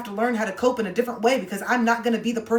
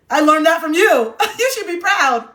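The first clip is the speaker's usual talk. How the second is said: louder and higher, laughing through the words; laughing taunt; 9 semitones higher, 10 dB louder than usual. Real, not acted. Host mocking mom's rules, laughing through it. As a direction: gloat while cracking up.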